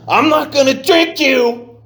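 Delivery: slow, high, loud scream at the bottle. Retold or imitated. Drunk driver screaming at the whiskey bottle on the seat. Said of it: "you're screaming at it".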